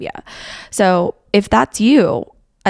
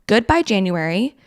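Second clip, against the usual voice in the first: ordinary delivery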